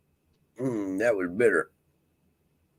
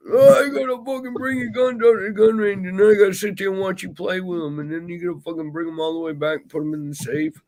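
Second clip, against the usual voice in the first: high, whining mock of the brother, rushing on; mock the brother's complaining in a high whine; whiny brother; 13 semitones higher, 4 dB louder, faster than usual. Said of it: "Oh, he fucking".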